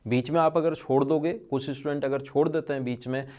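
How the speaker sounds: ordinary talk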